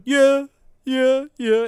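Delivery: high, spaced-out background ad-lib. Retold or imitated. Podcaster mocks producer's only part in the song, high ad-lib. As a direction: mimic a producer's high repeated vocal hook. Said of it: "He went".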